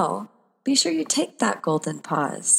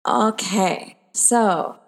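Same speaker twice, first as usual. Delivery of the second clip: ordinary delivery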